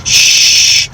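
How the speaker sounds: long, hissing "shh"; drawn-out shush. Retold or imitated. Storyteller mimicking the woman from Shutter Island, drawn-out shush. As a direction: imitate a film character's long shush. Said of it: "where she goes".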